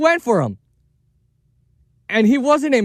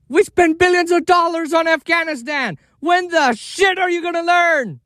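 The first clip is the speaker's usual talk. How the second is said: angry shout; 6 semitones higher than usual. Real, not acted. Ranting about war spending.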